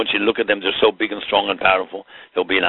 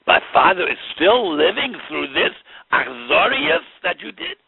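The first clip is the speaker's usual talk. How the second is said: much higher, rising indignant cry; outraged rebuke; 12 semitones higher than usual. Acted. Yosef rebuking his brothers for their cruelty, high outraged cry. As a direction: hurl the accusation, pitch climbing high.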